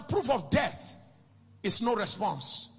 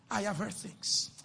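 ordinary talk

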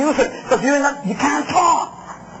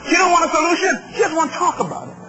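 ordinary talk

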